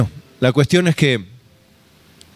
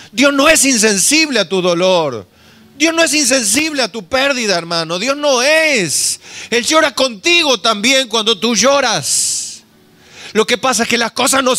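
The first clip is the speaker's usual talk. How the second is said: fervent preaching; 9 semitones higher than usual. Real, not acted. Preacher insists God feels pain.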